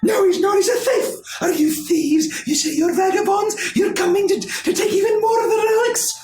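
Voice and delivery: high, loud, accusing hen; high angry chicken interrogation. Acted. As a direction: shriek at the suspected thieves. GM voices chicken woman.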